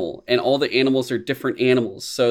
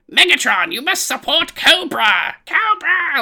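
much higher, louder screeching villain cry; shrill Cobra villain; 6 dB louder, slower than usual. Retold or imitated. Fan imitating Cobra yelling at Megatron, high and loud. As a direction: screech it like a cartoon snake villain. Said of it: "Cobra being like".

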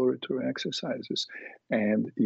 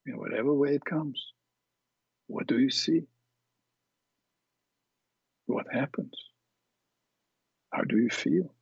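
guided meditation. Acted. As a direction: guide them calmly through it. Leads a guided imagery exercise.

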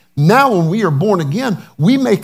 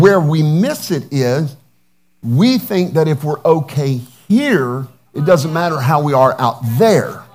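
ordinary talk, nothing marked

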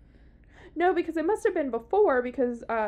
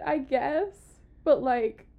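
ordinary delivery

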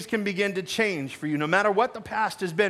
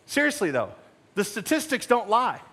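normal talking voice

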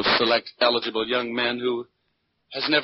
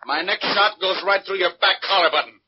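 commanding shout; 9 semitones higher than usual. Acted. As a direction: bark the order to drop the gun. Detective bursts in on gunman.

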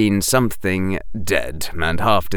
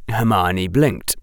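ordinary delivery, nothing marked

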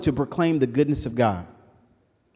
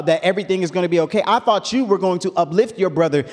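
put-on raised, quick preaching as the young Elihu; Elihu's rebuke; 4 semitones higher, 4 dB louder than usual. Young Elihu scolding the old friends, raised preaching. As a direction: scold the elders at full preaching force.

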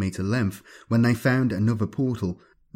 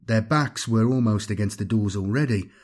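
plain reading voice

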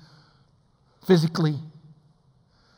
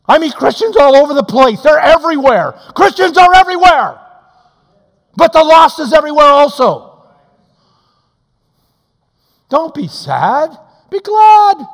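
loud, high preacher's cry; full-force revival sermon; fiery preaching; 13 semitones higher, 14 dB louder than usual. Acted. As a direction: preach it out to the back pews.

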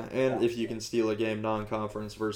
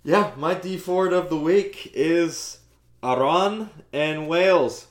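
slow, loud and higher; hyped announcement; 6 semitones higher, 6 dB louder, slower than usual. Real, not acted. Host reveals his pick, slow then shouting.

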